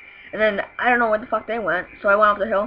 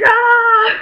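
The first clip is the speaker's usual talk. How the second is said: high, very loud drawn-out yell; imitated shooting scream, full voice; battle yell; 14 semitones higher, 10 dB louder than usual. Dreamer firing at the cops, battle yell.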